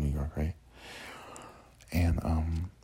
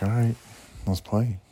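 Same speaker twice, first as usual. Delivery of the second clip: eager; 5 semitones higher, 4 dB louder than usual. Retold or imitated. Agreeing to play ball.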